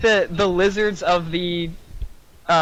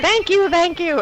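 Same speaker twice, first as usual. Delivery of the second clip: much higher, slow goblin voice; grateful goblin; 12 semitones higher, 4 dB louder than usual. Acted. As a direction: gush thanks in a squeaky creature voice. Goblin thanks the party.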